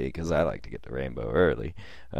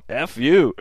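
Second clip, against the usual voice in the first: higher and louder mock yell; retold scream; 9 semitones higher, 7 dB louder than usual. Angry friend yells at narrator while leaving, mock yell. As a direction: bark it back as he storms off. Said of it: "And he screams".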